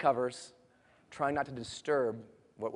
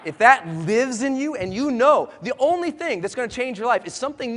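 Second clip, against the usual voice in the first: loud, higher, driving motivational preaching; rousing speech; 6 semitones higher, 6 dB louder than usual. Acted. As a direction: whip the room into action. Motivational speaker rallying the crowd.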